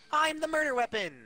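ordinary delivery

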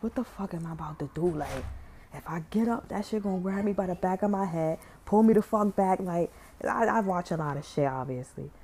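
frightened hush. Real, not acted. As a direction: keep it down so it can't hear you. Quiet scared retelling.

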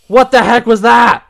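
high, startled question; alarmed outburst. Real, not acted. Gamer reeling from a scare, alarmed. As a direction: demand it in shock. Shaken after jump scare.

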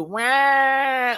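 high loud mock meow cry; cat yowl. Voicing the cat with a high mock yowl. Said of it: "Mina's like".

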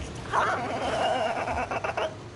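real laughter breaking out; burst of laughter. Real, not acted. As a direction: crack up laughing. Laughs at own joke.

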